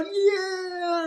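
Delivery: high, drawn-out mock cheer. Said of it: "I was just making fun".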